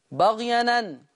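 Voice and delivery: بغيا أن: فتحة الهمزة على النون، بغيَنَ; انقل حركة الهمزة إلى الساكن قبلها; نقل حركة الهمزة. Shown as a demonstration. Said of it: "بينقل حركة الهمزة". (بغيا أن بالنقل)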